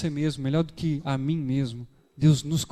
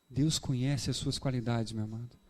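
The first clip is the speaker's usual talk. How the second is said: tender reassurance; 4 semitones lower, 7 dB quieter than usual. Real, not acted. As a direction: comfort the listener gently. Preacher comforts congregation tenderly. (reafirmação suave e carinhosa, mais devagar)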